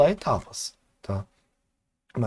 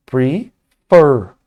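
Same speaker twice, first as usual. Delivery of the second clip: "prefer" with "fer" hit hard and much louder; stress on the last syllable; 10 dB louder than usual. Shown as a demonstration. Teacher showing students where the stress falls, punched. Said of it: "Aonde que tá o stress?".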